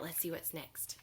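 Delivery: ordinary delivery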